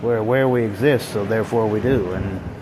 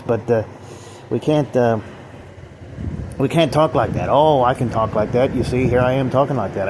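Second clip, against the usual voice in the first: normal talking voice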